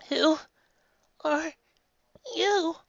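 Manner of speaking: put-on high childlike question; small pony calling up to the stage; young filly calling. A narrator's reading. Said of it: "called out a young-sounding voice".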